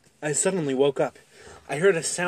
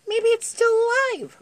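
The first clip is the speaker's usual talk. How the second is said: put-on high child voice; 6 dB louder than usual. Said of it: "I mumbled".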